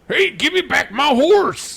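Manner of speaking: high, loud, slow hollering cowboy; cowboy yell. Retold or imitated. Imitating a Red Dead voice actor, loud high cowboy yell. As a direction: holler like an angry cowpoke. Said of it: "that guy in Red Dead Redemption that's like".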